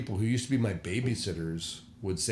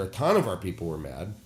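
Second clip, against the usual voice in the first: usual speaking voice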